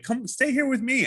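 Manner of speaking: high-pitched coaxing plea; playful high invitation. Acted. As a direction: coax a lover in a high voice. Voicing the suitor's coaxing plea.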